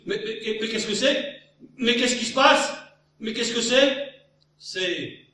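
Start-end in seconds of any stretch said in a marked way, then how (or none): 0.1-4.1 higher, louder panicked questions; breathless horse. Acted. Exhausted galloping horse asks what's happening, breathless. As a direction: gasp it out, alarmed. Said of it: "essoufflé".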